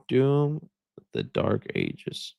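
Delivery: very low, slow title read; mock trailer voice. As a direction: intone it like a game trailer, playfully. Playfully intones the game title.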